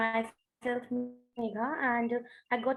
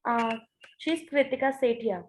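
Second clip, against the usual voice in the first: conference call; 13 semitones higher than usual. Real, not acted. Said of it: "your audio is cutting out".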